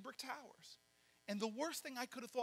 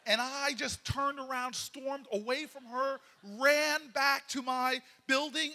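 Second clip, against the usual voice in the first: slow, loud, heated telling; slow angry storming off; stormy; 3 semitones higher, 8 dB louder, slower than usual. Retold or imitated. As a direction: relive the fury.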